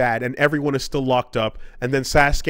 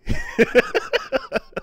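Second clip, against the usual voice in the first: a sudden high squeal of a laugh; high burst of laughter. Real, not acted. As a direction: crack up high and breathless. Speaker laughs at own bit.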